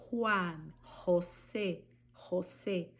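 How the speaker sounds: Juan, José with throaty J; Spanish J. Shown as a demonstration. Teacher drilling J words for students. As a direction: say José with rasping J. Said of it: "vejam também o som".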